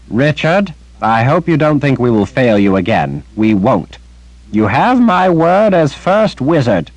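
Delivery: put-on the old grandfather swears on his wizard's honor; old wizard Zed. Old wizard Zed vows loyalty.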